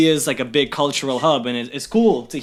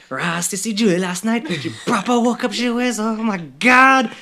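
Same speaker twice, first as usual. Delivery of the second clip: imitating mom's higher Guyanese accent; 8 semitones higher than usual. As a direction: mom gossiping in thick Guyanese, pitched up. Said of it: "like, all Guyanese".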